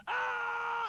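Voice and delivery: high, loud yell of pain; mock scream. Elephant hit on the head by coconut, mock scream. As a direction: let out a long comic shriek.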